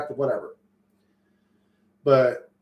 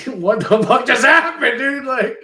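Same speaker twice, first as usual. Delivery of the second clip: disbelief shout; 10 semitones higher, 7 dB louder, slower than usual. Retold or imitated. Stunned after meeting the husband.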